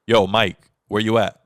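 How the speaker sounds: relaxed loud yo to a homie; casual callout. Retold or imitated. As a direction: toss it off like a quick text to a friend. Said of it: "no, I'm like".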